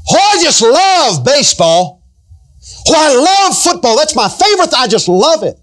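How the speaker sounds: high, swooning fan gush; gushing mock delight. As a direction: gush about football like a giddy fan. Mimicking gushing about sports.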